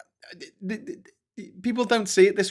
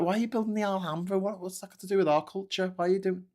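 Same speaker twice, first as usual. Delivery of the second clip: mock bewildered protest; 8 semitones higher, faster than usual. Imagined British objection to Alhambra.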